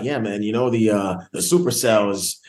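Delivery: put-on low-pitched East Coast New York accent. Actor showing how he'd clash with Southern castmates, New York accent. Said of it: "this New York ass accent".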